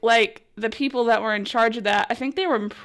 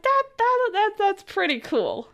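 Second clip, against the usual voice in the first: mock stunned awe; 10 semitones higher than usual. Quoting own reaction to shout-out.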